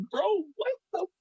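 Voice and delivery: high-pitched, baffled retort; retold exasperated squeak; retold disbelief. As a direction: voice jumps up, incredulous. Retold exasperated reaction to him.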